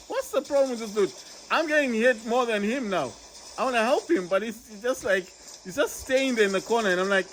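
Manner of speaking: high, fast frustrated pleading; exasperated gamer begging teammates for help; exasperated excited. Real, not acted. Teammates not helping in battle.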